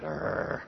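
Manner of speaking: breathy voiceless mock dog growl; preacher mimicking his dog's snarl, unvoiced growl.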